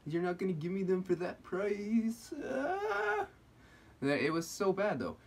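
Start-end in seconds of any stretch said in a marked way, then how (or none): 0.1-2.3 high-pitched mock complaining customer; hammed-up triggered whine. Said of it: "he was getting all triggered". 2.5-3.3 very high squealed wail; drawn-out falsetto mock scream; mock triggered scream. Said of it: "he was getting all triggered".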